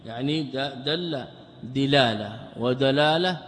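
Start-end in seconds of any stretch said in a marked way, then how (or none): 1.6-2.4 دلالة with an i after the dal, "dilala"; kasra on the dal. Shown as a demonstration. Teacher showing students the variant vowelling, دلالة with kasra, "dilala". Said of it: "بكسرها". 2.6-3.4 ودلالة with an a after the dal, "wa-dalala"; fatha on the dal. Shown as a demonstration. Teacher showing students the purer form, ودلالة with fatha, "wa-dalala". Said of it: "بفتح الدال على الأفصح".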